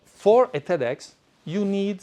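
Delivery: ordinary talk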